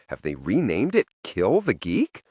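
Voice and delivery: ordinary delivery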